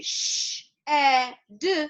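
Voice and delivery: "sh, e, d" as three separate phonemes; sounded out. Shown as a demonstration. Teacher showing where the digraph is heard, sounded out. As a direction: say each sound of "shed" apart. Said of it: "we hear it when we say".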